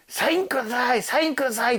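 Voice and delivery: put-on excited children asking for an autograph; imitating a kid asking for a signature; eager kids.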